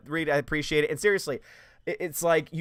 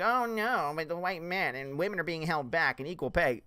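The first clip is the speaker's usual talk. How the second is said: mock whine. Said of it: "you're supposed to be like".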